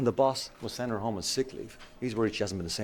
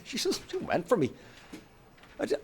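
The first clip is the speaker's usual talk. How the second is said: upset; 12 semitones higher than usual. Acted. Retelling attack, faked upset.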